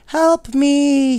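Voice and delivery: high, loud little bear's cry for help; a stuck toy bear pleading out loud; teddy bear calling. A narrator's reading. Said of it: "he called to two dolls".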